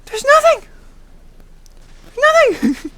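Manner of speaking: very high, loud cry of frustration; exasperated outburst. Real, not acted. Customer who got neither food nor money, exasperated outburst. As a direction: squeal it out, fed up.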